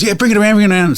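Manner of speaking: lightly voiced detailer saying no worries; breezy tradie. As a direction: play the relaxed car-wash guy. Voices the car-wash guy again.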